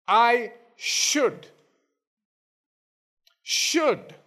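SHOULD isolated between long pauses, pitched high; stress on SHOULD. Shown as a demonstration. Preacher contrasting should with would, stress on SHOULD. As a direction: let SHOULD stand alone, high and firm. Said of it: "there is an emphasis on that word".

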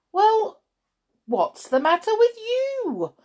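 very high, loud astonished question; surprised. A narrator's reading. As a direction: exclaim it, utterly taken aback. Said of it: "he stopped in surprise".

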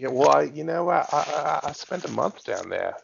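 mock Australian with a lifted pitch; Australian accent. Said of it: "you have been working on it".

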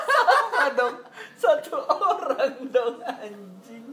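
high, loud words breaking into laughter; laughing hard. Real, not acted. Host tickled by a namesake in the email, laughing hard. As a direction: crack up while talking, pitch shooting up.

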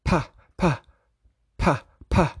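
"Puh" as a soft breathy puff; puh sounds. Shown as a demonstration. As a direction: repeat "puh, puh" like a phonics drill. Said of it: "Say your P's".

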